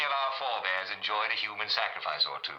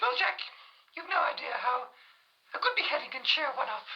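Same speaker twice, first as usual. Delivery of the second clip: much higher, haughty royal lady; Queen Mary. Acted. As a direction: ask it like a queen looking down. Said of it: "Her Majesty Queen Mary".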